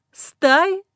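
high mocking question with a small laugh; with a chuckle. A narrator's reading. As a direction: echo it back with a smirk. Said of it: "со смешком переспросила я".

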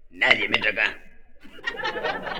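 very slow, low tough-guy drawl; tough doorman. Acted. Doorman boasts to himself, very slow and low. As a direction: lay it down like a bouncer.

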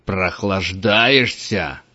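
slow drawn-out threatening bellow; menacing roar. A narrator's reading. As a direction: catch the slacker out. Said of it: "грозно заревел Чейд". (грозный рёв)

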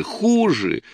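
wife crying out at her husband, high; shouted out. A narrator's reading. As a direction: fling "хуже" out as a sudden high cry. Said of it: "выкрикнула Рая".

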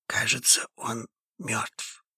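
very soft, almost soundless; hushed whisper. A narrator's reading. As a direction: drop to a breath. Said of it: "также неслышно ответил Андрей". (неслышно, шёпотом)

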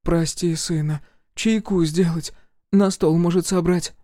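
высокий умоляющий голос матери, нарастает к концу; заклинает сына не ходить, всё громче. A narrator's reading. Mother voiced high. (высокая мольба матери, громче к концу)

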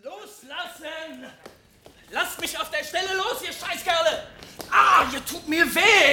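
höher, sich wehrend schreien; wütender Schrei. Acted. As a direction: gegen die Wachen anbrüllen. Melvin wird weggezerrt. (Melvin schreit, als man ihn wegzerrt)

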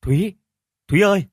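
loud, lilting summons; calling out. A narrator's reading. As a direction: call for your wife from the doorway. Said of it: "nhìn vào trong nhà rồi gọi".

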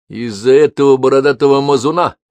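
loud scornful question, rising on этого; contemptuous. Acted. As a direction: sneer at the bearded dauber. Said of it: "презрительно посмотрел на Алексея брат".

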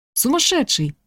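high-pitched, mild exclaimed shout; shouting after someone. A narrator's reading. Woman to reckless driver speeding away, shouting after him. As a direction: cry it out after someone leaving. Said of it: "крикнула она ему вслед".

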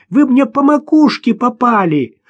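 put-on high, indignant child call; girl's voice. A narrator's reading. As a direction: complain loudly as a little girl. Girl protests after hit. (возмущённо, детским голосом)

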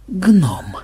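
stunned, hoarse single word. A narrator's reading. Programmer realises what Махмуд has become, mildly hoarse and stunned. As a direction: let the voice catch in dismay. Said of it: "осипшим голосом закончил он".

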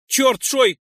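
indignant shout at a careless friend; outraged. Acted. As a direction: blurt it out in alarm and anger. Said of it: "возмутился Нойс".

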